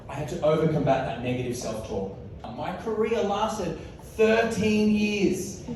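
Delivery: ordinary talk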